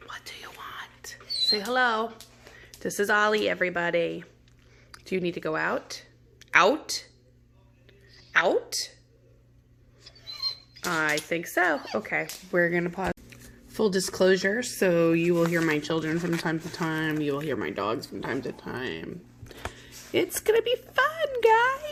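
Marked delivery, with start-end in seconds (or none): 0.1-2.1 soft whisper to the dog. Real, not acted. Teacher whispering to her dog, soft and unvoiced. 20.1-21.9 high, slow sing-song; mock chirpy. Real, not acted. Teacher tells students about noisy dogs, high teasing sing-song.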